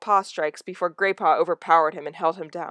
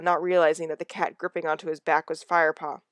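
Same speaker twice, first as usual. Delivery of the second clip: usual speaking voice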